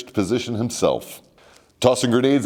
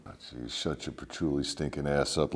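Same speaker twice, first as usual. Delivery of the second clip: annoyed insult; 7 semitones lower, 13 dB quieter than usual. Acted. Snaps at stoner roommate.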